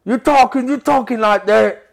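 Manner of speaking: high, loud hammed-up De Niro; angry De Niro improv, overacted; De Niro impression. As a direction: do the you-talking-to-me De Niro. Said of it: "This is my De Niro face".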